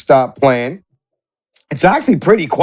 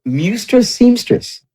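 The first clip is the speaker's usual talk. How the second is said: cat voice; 5 semitones higher, slower than usual. Acted. GM voices the cat's name.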